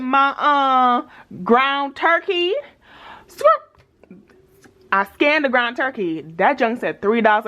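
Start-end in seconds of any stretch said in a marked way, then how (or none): 3.3-4.2 high squeaky sound effect; squeaky squirt sound. Retold or imitated. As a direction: squeak it out like a scanner beep. Sound of the scanner.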